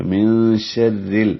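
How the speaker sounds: من شر ال slowly, the ra light and thin. Shown as a demonstration. Teacher models the thin ra, slow. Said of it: "اب یہ را باریک ہے".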